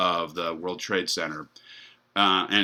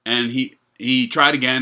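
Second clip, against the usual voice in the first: normal talking voice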